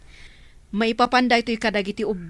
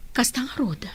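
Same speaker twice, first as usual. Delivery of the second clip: whisper; 2 semitones higher, 4 dB quieter than usual. Acted. Quiet agreement in conversation.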